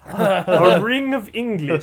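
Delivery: amused, stretched laughing echo; laughing repeat. Real, not acted. GM laughing as he echoes the player's joke. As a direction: savour the joke, slow and laughing. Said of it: "GM laughs, repeating the line".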